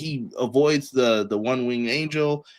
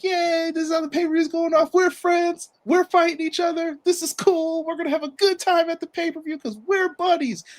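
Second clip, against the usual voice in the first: much higher, cartoonish happy voice; goofy cheerful Bucks. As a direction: play them as clueless, overjoyed kids.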